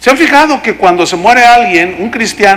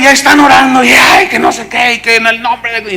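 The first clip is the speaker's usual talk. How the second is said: raised, shouted prayer babble, easing off; mock noisy praying; 4 semitones higher, 3 dB louder than usual. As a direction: parody a shouting prayer chain. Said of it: "qué tanta gritería". (oración a gritos, en burla)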